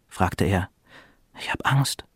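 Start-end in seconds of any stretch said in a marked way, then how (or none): 1.4-2.0 hushed, softer admission of fear; afraid. A narrator's reading. Youngest brother confides in the lake, afraid. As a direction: half-whisper it, a touch afraid. Frightened boy in deep water.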